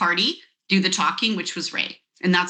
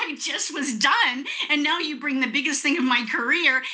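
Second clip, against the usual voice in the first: ordinary talk, nothing marked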